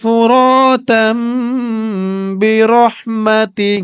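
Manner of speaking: tajweed recitation. Shown as a demonstration. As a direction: recite it slowly in a melodic chant. Quran-style recitation of a dua. (ধীর সুরেলা তিলাওয়াত)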